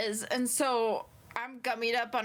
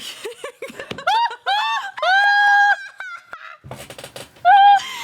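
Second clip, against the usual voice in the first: loud laugh; 14 dB louder than usual. Real, not acted. Bursts out laughing.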